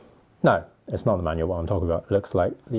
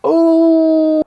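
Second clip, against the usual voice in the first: excited squeal; 12 dB louder than usual. Real, not acted.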